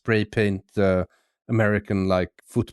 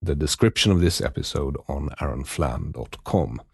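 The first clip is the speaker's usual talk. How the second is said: lower, measured outro announcer read; podcast outro read; 7 semitones lower than usual. Acted. Host signing off to listeners, announcer read. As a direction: sign off the episode like a host.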